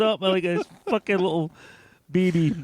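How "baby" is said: usual speaking voice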